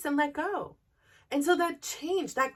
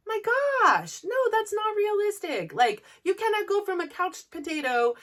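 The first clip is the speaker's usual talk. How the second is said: higher and louder, a startled no; incredulous; 6 semitones higher, 5 dB louder than usual. Real, not acted. Brushing off signing up for a half marathon, incredulous. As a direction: scoff at the idea of a sudden half marathon.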